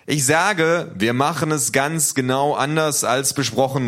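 laut und hoch, keck; Ruf von hinten. Acted. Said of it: "ruft Otto von hinten". (lauter, spöttischer Zuruf)

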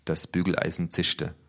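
plain reading voice

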